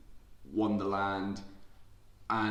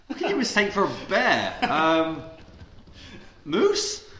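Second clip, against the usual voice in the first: laughing guess; 12 semitones higher, 8 dB louder than usual. Real, not acted.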